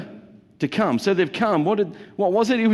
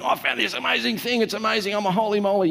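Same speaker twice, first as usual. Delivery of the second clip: higher, breathless gushing voice; gushing holy moly; 6 semitones higher, faster than usual. Pretend. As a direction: gush like an eager convert. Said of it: "They're saying".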